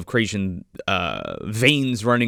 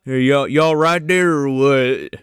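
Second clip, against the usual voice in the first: slow, drawn-out goofy big-man voice, louder; looming Lawnmower Man; 3 semitones higher, 5 dB louder, slower than usual. Acted. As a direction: act out a hulking oaf checking on someone. Voices the naked man standing over you.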